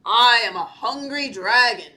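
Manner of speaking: lightly voiced dragon, loud; a hint of menacing creature; deep-voiced dragon. A narrator's reading. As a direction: announce it as a big storybook dragon. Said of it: "said a deep voice".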